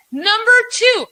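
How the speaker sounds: announcing loudly. Real, not acted. Host announcing next point.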